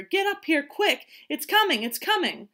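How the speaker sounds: put-on high, urgent kids calling out; excited yell. A narrator's reading. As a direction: yell up a friend in a hurry. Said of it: "laughed and yelled at her".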